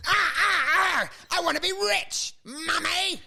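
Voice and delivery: high wailing brat voice; whiny spoiled child. Pretend. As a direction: whine it like a spoilt kid crying to mum. Whiny rich kid bashing console.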